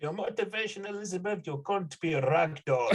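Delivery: high mock German-accented director; bad Verhoeven. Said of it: "some bad Verhoeven impression".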